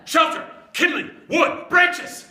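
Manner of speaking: high, loud barked list; excited shouts. Acted. Dad charging around the beach, frantic shouting. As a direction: call out each word in a frenzy.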